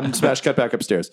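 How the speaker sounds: usual speaking voice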